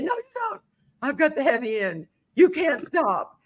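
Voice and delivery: high-pitched, exasperated retold objection; mock protest. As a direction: refuse in a high voice, dragging out the end. Retold argument carrying a mattress upstairs.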